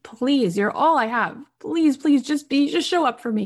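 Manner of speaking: high, urgent pleading; imploring retold prayer. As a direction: beg earnestly, pitch climbing. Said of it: "she prayed to God".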